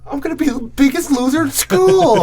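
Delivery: put-on a dramatic teen wails at her father; whiny teen. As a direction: wail it like a dramatic teenager. Acting spoiled teen.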